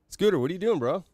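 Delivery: high, loud sing-song call to a pet; calling a dog. Real, not acted. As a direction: coax the dog awake.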